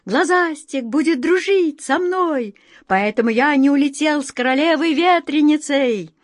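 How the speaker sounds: high, eager little wind voice; a playful whirlwind boasting; wind sprite voice. Acted. Vikhrik speaks.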